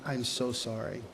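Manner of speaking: low, hushed apology, imitating someone near tears; retold tearful apology. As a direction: quietly quote a friend on the verge of crying. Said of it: "she starts crying a little bit".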